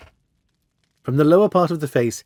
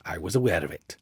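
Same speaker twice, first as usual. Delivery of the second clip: low, softer, understated reply; dry; 7 semitones lower, 5 dB quieter than usual. A narrator's reading. Holmes to the masked visitor's confession, dry. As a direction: drop it in dryly, unimpressed. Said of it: "said Holmes dryly".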